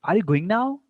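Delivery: teacher's demo question climbing on "now"; rising question tone. Shown as a demonstration. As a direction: lift "now" high at the end. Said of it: "Rising tone is there".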